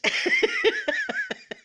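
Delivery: high, loud burst of laughter; big laugh. Real, not acted. Laughing with viewers about the jealous shop lady, loud high laugh. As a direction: let a real laugh burst out.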